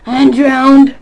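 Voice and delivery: loud, high outburst; sudden shout. Real, not acted. Player capping his swimming remark, sudden loud shout. Blurts out drowning in game.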